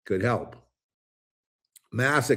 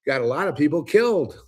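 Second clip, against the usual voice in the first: defiant retort; 6 semitones higher, 4 dB louder than usual. Retold or imitated. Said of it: "I say".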